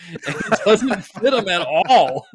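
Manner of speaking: says it through a laugh, pitch up. Real, not acted. Laughing at accent joke.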